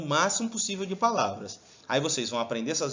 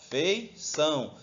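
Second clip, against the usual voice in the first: Feição split slowly into fei, ção; Feição drawn out in separate beats; syllable by syllable; much slower than usual. Shown as a demonstration. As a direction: clap out Feição with a gap between syllables. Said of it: "pra separar as sílabas, bate palma".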